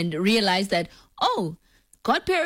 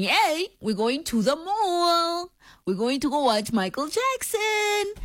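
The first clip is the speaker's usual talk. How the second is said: enticing announcement; 8 semitones higher, slower than usual. Retold or imitated. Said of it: "I've been saying".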